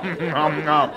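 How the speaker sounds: cartoonish "nom nom" chomping; mock munching noises. Serial killer eating salad with a spoon, mock munching. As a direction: chomp greedily on the syllables.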